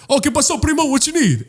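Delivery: high, upbeat imitation of a vendor; hearty vendor greeting.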